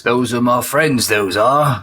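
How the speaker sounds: a light Hagrid impression; lightly voiced big friendly giant; mock Hagrid voice. Player teases by doing Joseph as Hagrid, lightly. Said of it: "Joseph is now Hagrid".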